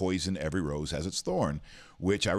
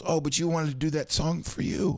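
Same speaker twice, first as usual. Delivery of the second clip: higher, lightly voiced sing-song woman; mock sweet guest; 10 semitones higher, 3 dB louder than usual. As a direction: play the guest remembering his song too late. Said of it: "and she goes".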